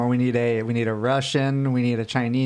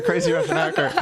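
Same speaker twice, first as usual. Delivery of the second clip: crazy Russian hacker; 4 semitones higher than usual. Pretend. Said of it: "I can do crazy Russian hacker".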